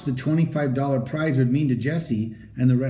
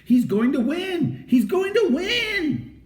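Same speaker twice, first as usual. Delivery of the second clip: excited crowd; slower than usual. A narrator's reading. Crowd cheering at finish.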